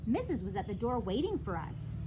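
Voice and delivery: ordinary delivery, nothing marked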